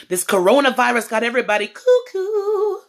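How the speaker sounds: normal talking voice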